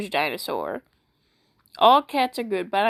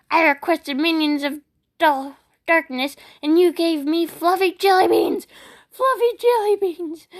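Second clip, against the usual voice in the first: mock outrage; 6 semitones higher than usual. Mock outrage over fluffy cats.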